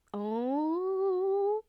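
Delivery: high, loud sudden oh; surprised oh. Real, not acted. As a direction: catch yourself in a realization. Sudden realization mid-thought.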